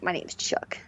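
a hint of an old-school tough guy.

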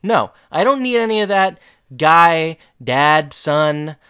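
hammed-up scolding no; mock firm refusal. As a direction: shut it down like an exasperated wife. Wife firmly refusing husband's offer.

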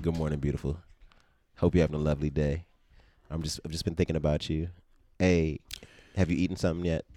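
soft, tender morning greeting; sweet talk. Acted. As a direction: lay on the charm gently. Sweet texts acted for girlfriend.

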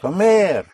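comer with a high, loud final MER; stress on the ending. Shown as a demonstration. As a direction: punch the end of comer, co-MER. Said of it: "you hit the ending".

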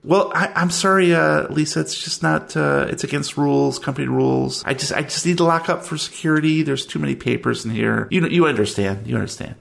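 high, eager clerk voice, a bit fast; chirpy office clerk. Acted. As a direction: play the fussy storage clerk, all smiles. Clerk refuses.

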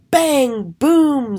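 high, punched-out sound words; magical bang. A narrator's reading. Narrator tells of the spell going off, excitedly. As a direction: punch them up like explosions.

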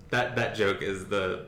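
normal talking voice

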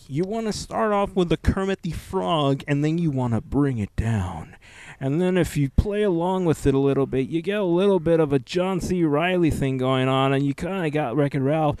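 a light impression demo of voice steps; imitating voice breakdown. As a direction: lightly play through cartoon voices. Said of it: "Frank Caliendo is breaking down how he'll do".

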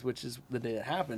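ordinary delivery

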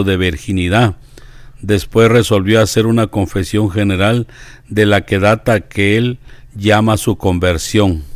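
measured devotional biography narration; deliberate reading with pauses between phrases; reading aloud. Acted. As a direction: read it out clearly, phrase by phrase.

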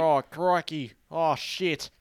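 loud, high Aussie exclamation; exaggerated Australian swearing. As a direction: ham up the Australian accent. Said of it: "turn it into a Australian swearing".

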